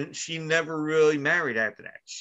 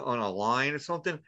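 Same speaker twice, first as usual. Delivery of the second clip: ordinary talk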